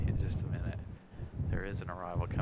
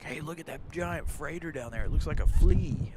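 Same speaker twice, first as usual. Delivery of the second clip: higher, excited window-seat exclaim; excited sightseer; 7 semitones higher, 7 dB louder than usual. Retold or imitated. Husband at the window seat, excitedly pointing out a freighter below. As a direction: point out the tiny ship with glee. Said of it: "I'd be like".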